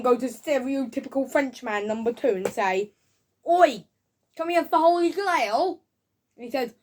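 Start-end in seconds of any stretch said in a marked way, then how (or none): 3.4-5.8 high, loud mock hail; calling out. Reviewer acting a man hailing the Frenchman, loud mock call. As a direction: call up to someone in an acted scene.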